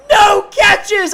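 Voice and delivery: high, loud laughing cry of disbelief; cracking up, yelling in disbelief; excited shout. Real, not acted. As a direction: shout it out in mock outrage. Laughing outburst about Tank Dell.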